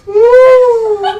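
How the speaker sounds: very high, loud startled woo through laughter. Retold or imitated. Trainee reacting to the slicer, a high laughing woo. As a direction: squeal it like a shocked trainee.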